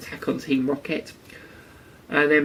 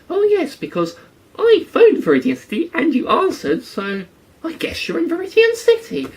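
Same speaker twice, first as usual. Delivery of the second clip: put-on much higher, louder goofy old-man voice; mock dim professor; 4 dB louder than usual. Mocking Professor Oak's phone logic, high silly voice. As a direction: spell out the obvious like a proud fool.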